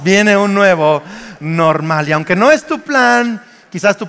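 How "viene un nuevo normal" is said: slow, drawn-out "viene un nuevo normal"; emphatic repeat. Shown as a demonstration. Preacher urging congregation to tell a neighbor, slow emphatic repeat. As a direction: stretch out "nuevo normal" to make it land.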